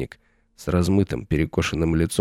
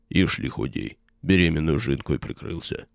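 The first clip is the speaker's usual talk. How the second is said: bass warrior voice; 2 semitones lower than usual. A narrator's reading. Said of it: "басом подтвердил второй воин".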